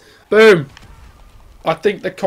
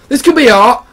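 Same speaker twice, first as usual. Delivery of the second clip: insistent repeat; 8 semitones higher, 8 dB louder than usual. Pretend.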